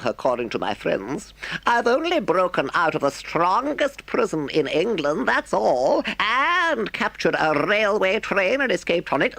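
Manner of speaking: high, mock-modest Toad voice; a toad swelling with pride; boastful Toad. A narrator's reading. Toad fakes modesty to Mole, boastful.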